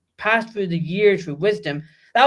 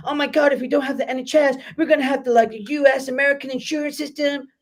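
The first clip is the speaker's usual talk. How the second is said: mock panicked Brit; 8 semitones higher, faster than usual. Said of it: "we go".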